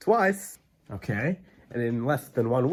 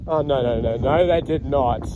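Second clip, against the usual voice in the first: normal talking voice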